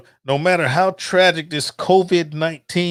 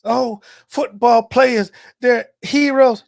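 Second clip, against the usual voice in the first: mock naive fan; 7 semitones higher, slower than usual. Mocking what people used to think.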